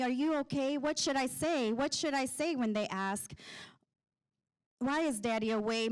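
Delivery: ordinary talk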